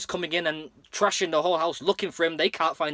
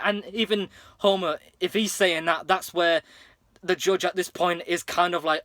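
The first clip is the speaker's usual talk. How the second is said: usual speaking voice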